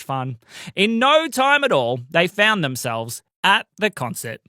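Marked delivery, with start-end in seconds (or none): none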